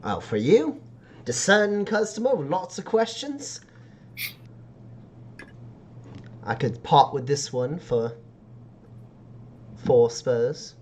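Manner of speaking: lightly voiced backstreet dealer; haggling street seller. Seller haggling with a customer full of questions, lightly voiced dealer. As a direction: size up the buyer and name a price.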